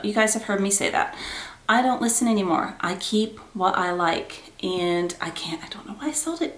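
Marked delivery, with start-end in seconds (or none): 4.6-6.6 whispered, wistful regret; soft whisper of regret; regretful whisper. Real, not acted. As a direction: hush it like a confession to yourself. Regret over selling bag.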